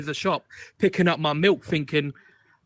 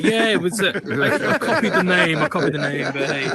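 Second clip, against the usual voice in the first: laughing; 4 semitones higher, 6 dB louder than usual. Real, not acted. Admits copying the name, laughing.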